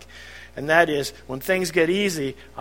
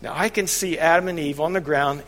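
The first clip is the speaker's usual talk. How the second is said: ordinary talk, nothing marked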